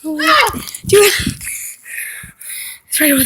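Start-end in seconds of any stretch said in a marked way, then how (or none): 0.0-1.1 high urgent yelling; shouting. Real, not acted. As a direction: shout a warning across. Warning friend of monster.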